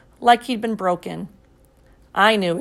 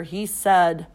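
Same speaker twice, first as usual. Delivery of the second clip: ordinary delivery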